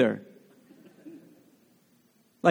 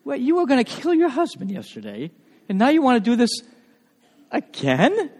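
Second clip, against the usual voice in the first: incredulous; 6 semitones higher than usual. Acted. Teasing the new mother.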